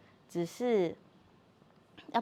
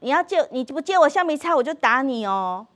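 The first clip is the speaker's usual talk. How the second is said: loud, high schoolkid threat, pitched up; loud child threat; 6 semitones higher, 9 dB louder than usual. Retold or imitated. Classmate demanding an eraser from the daughter, in a loud high threat. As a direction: shout the threat like a pushy classmate. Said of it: "跑去跟我女儿说".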